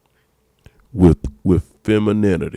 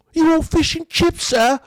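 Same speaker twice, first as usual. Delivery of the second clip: very high mock British voice; hammed-up British accent, eager to serve up high; 4 dB louder than usual. Women fussing over Idris Elba, high mock British accent. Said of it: "You would do his accent".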